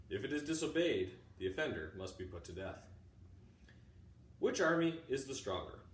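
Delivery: ordinary talk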